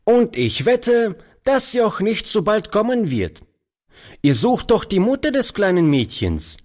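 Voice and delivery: put-on high, lively boyish voice; young urchin chatting to an old man; cheeky street boy. A narrator's reading. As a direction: play the ragged boy, bright and sure. Boy character voice.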